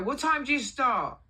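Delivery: high, loud interrogation; imitated boss's scolding question; indignant boss. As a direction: demand it like an exasperated boss. Acting out boss's telling-off.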